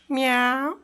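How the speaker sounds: put-on high squeaky child's meow; cat meow. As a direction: mew like a little kitten. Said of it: "പൂച്ച എങ്ങനെ കരയുന്നേ?". (ഉയർന്ന പൂച്ച കരച്ചിൽ)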